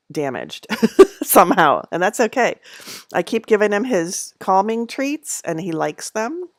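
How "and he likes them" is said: ordinary delivery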